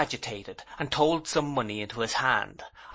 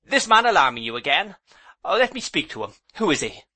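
hurriedly; 6 semitones higher, 5 dB louder, faster than usual. A narrator's reading. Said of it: "said I hurriedly".

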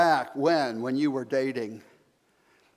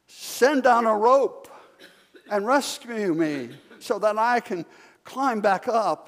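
desperate plea; 7 semitones higher, 4 dB louder than usual. Acted. Jogger on the cliff begging the voice for a rope. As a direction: beg for the rope.

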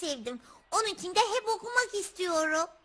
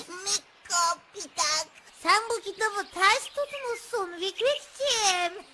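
ordinary talk

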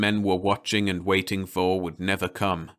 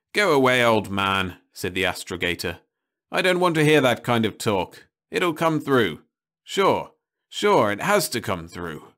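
louder, higher, waving him off; angry dismissal; 4 semitones higher, 5 dB louder than usual. Acted. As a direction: drown out the bad news angrily. Astrogator brushing off the old man.

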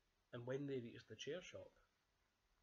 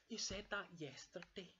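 lightly voiced bear cub, higher; a higher, childlike protest; Baby Bear complaining; 7 semitones higher than usual. Baby Bear reminds Dad of his promise, lightly childlike complaint. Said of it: "said Baby Bear".

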